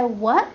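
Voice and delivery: WHAT swooping up at the end; surprised rising tone. Shown as a demonstration. Teacher shows English rising tone, surprised question. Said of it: "When you have a surprising".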